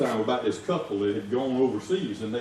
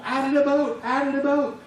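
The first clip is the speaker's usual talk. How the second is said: high urgent repeated order; lightly voiced frantic boat owner; boat owner yelling; 11 semitones higher than usual. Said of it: "the guy just kept on".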